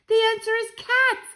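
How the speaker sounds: high, loud announcement; excited shout. A narrator's reading. Child reveals the plan to grandmother, shouting excitedly. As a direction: call it out at high pitch. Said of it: "I shouted".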